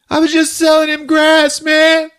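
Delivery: high, loud, desperate yell; panicked shout. Retold or imitated. Skip, questioned by the chief, shouting a desperate excuse.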